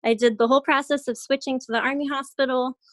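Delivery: ordinary talk, nothing marked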